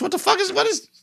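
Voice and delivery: very high, squeaky bafflement; incredulous high-pitched sputter; baffled disbelief. Acted. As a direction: react in falsetto, utterly thrown. Mocking reaction to the British line.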